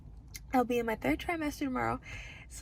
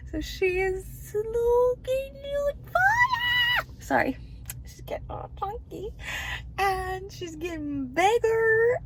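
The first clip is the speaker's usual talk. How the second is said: gushing joy; 11 semitones higher, 5 dB louder than usual. Real, not acted. Gushing over growing baby.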